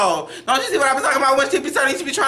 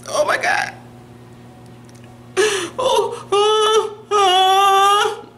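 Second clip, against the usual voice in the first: mock crying; 9 semitones higher, much slower than usual. Impression mourning [name].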